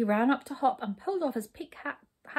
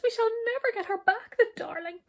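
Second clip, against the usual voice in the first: high, loud lament; sobbing queen; 13 semitones higher, 4 dB louder than usual. A narrator's reading. Queen grieving for her darling, high tearful wail. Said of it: "sobbed the queen".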